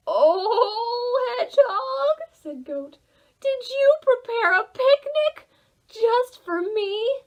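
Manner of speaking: high, loud gleeful goat voice; greedy goat. Acted. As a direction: gush like a hungry goat spotting food.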